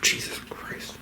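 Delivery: whispered under the breath in exasperation; exasperated whisper. Real, not acted. Owner whispering in exasperation at the cat. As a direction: mutter it unvoiced, fed up.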